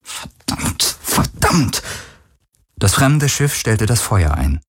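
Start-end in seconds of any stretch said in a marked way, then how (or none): 0.0-1.0 very soft, whispered mutter; murmured curse. A narrator's reading. Captain cursing over and over, murmured. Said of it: "murmelte sie immer wieder". 1.0-1.8 hissed curse jumping higher; muttered curse. A narrator's reading. Captain cursing over and over, sharper mutter. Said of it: "murmelte sie immer wieder".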